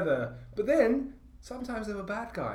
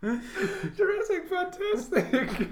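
high, slow squeal through laughter; helpless laughter; 12 semitones higher, much slower than usual. Real, not acted. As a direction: barely get the words out for laughing. Cracking up at listener's wording.

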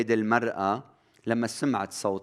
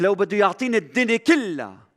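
grieving mother's cry; 9 semitones higher, 6 dB louder, faster than usual. Acted. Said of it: "الأم عم تطلع".

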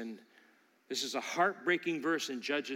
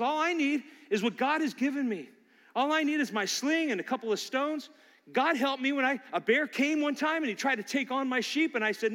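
pitched much higher, bold and steady; confident young David; 8 semitones higher than usual. Retold or imitated. Preacher voicing David boasting of God's help before Saul, confident. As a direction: declare it like a shepherd who fears nothing.

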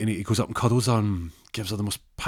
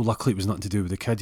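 usual speaking voice

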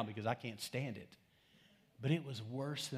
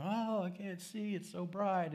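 high, drawn-out mock complaint; slow, higher-pitched put-on wail; dazzled person emerging; 6 semitones higher, slower than usual. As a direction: play someone blinded by sudden sunlight. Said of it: "coming out into sunlight after fourteen months".

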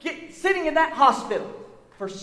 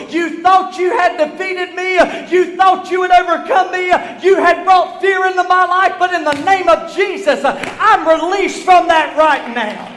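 rising preacher shout, louder with each line; defiant shout; 4 semitones higher, 5 dB louder than usual. Retold or imitated. Preacher defying the devil before the church, building shout. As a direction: throw it at the enemy with full force.

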